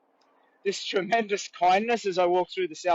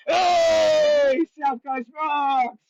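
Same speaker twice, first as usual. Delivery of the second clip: loud high shout, slowing on South Coast rocks; shouted cheer; 9 semitones higher, 5 dB louder, much slower than usual. Pretend. Walker shouting the viewer's comment to the world, loud slow cheer. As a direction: belt it out like a cheer. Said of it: "Just go".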